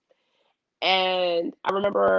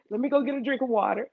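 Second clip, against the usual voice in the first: quick, quiet escape line; nervous speaker fleeing for water; anxious rush; 4 semitones higher, 4 dB quieter, faster than usual. Retold or imitated. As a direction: hurry it out in a panic. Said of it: "And then anxiety".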